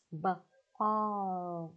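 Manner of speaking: "B-, ball" split, short b then long aw; sounding out. Shown as a demonstration. Teacher helping children repeat, sounding out "B-, ball" slowly. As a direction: break "B-, ball" into b, pause, drawn-out aw.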